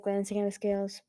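plain reading voice

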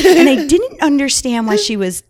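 telling it through giggles; laughter breaking into the words; real laughter; laughing. Real, not acted. Laughing while telling story.

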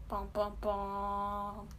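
a hint of a suspense soundtrack; mock dramatic sting. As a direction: sing a slow doom sting. Dramatic sting after execution.

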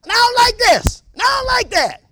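loud, high complaining shout; mock morning rant at his wife; grumpy yell. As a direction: yell at the wife about the day. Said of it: "I just said".

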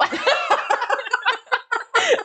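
bright high burst of laughter; high delighted laugh. Real, not acted. Reacting to announcer intro.

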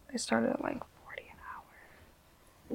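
drawn-out hushed whisper; slow whispered admission. Real, not acted. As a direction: whisper it slowly, like a secret. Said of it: "Why you whisper that?".

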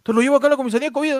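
fast cop talk. Acted. Policeman in skit.

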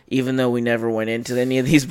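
normal talking voice

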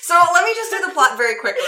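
laughing, high, fast and loud. Real, not acted. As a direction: rush it out through laughter. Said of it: "we got one sentence into the plot".

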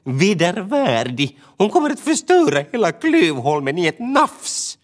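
bird in dramatic alarm; hammed-up horrified exclamation; exclaiming. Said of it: "utbrast Tova".

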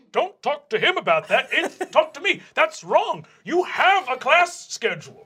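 jealous, cracking protest; flustered vice principal. Acted. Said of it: "Goldenhorn says".

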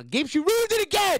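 high, loud playful accusation; mock outburst. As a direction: whine it at your friend in fun. Mock blaming friend for lost thought.

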